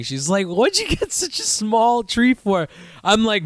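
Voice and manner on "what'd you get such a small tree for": high, slow mock-mom complaint; mom slamming me. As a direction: mimic mom's dig in a raised voice. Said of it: "my mom fucking wants to slam me".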